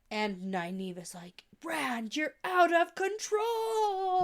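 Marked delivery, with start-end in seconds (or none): 1.6-4.2 high, drawn-out mock wail; hammed-up alarm. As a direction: wail it slowly, overdramatic. Acted outburst of alarmed Nynaeve.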